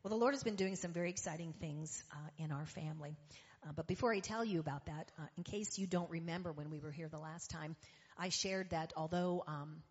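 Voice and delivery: usual speaking voice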